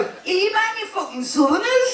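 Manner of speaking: hammed-up Geordie yell, pitched well up; imitating Newcastle lads jeering at the Southerners, Geordie accent; mock Geordie. Retelling Newcastle kids taunting her family, mock Geordie shout. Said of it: "And they go".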